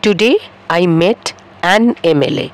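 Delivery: slow, careful English sentence; slow model sentence. Shown as a demonstration. Teacher modelling the English sentence slowly. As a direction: say it slowly so students copy. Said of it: "তাহলে আমি বলবো".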